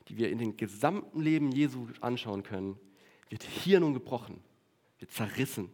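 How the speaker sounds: ordinary delivery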